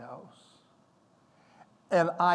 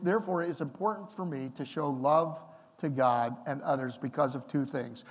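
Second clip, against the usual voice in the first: ordinary talk, nothing marked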